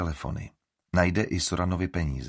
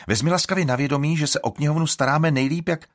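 indignant; 8 semitones higher, 5 dB louder than usual. A narrator's reading. Estrid bristling.

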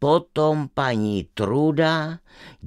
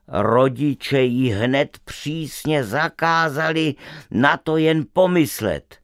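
ordinary delivery, nothing marked

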